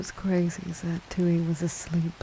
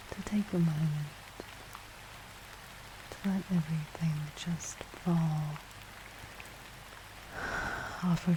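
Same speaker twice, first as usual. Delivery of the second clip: guided relaxation; 7 dB quieter than usual. Acted. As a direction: gently guide the breathing, hushed and calm.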